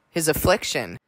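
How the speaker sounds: normal talking voice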